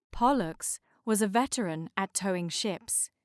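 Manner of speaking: plain reading voice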